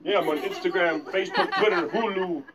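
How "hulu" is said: usual speaking voice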